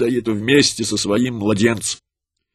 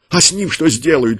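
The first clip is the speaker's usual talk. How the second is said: higher, louder desperate cry; mother's cry; 5 semitones higher, 4 dB louder than usual. A narrator's reading. Mother crying out to the soldiers for her son, higher and louder. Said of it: "крикнула она".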